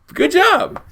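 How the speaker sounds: high, loud mock game show praise. Real, not acted. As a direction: beam out the compliment. Host praising the guest.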